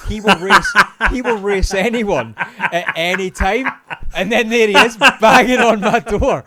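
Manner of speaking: loud, high, helpless laughter through words; laughing. Real, not acted. Storyteller reaching his mates' prank punchline, really laughing. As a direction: crack up while drawing the words out slowly.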